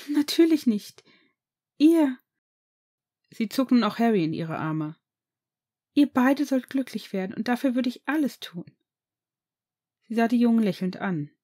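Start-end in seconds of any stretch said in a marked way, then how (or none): 0.1-8.7 higher, warm and emphatic; fervent mother. Acted. Mother pulling both boys close, fervently reassuring. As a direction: embrace them with your voice.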